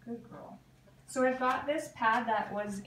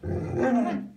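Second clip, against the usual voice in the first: much louder call of the dog's name; loud firm call; 11 dB louder, much slower than usual. Real, not acted. Trainer calling her frustrated dog, loud. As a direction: call her sharply across the room.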